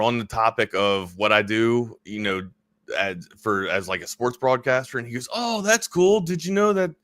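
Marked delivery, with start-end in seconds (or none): 5.3-6.9 lightly imitated French-Canadian accent, higher pitch. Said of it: "he's got a French accent too".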